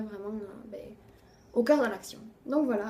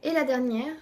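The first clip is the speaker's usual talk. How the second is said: ordinary delivery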